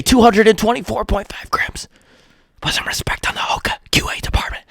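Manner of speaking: high, breathy whispered brag; mock Hoka whisper. Hoka gloating over the scale number, whispered mock brag. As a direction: whisper the boast like a secret flex. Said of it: "Hoka said".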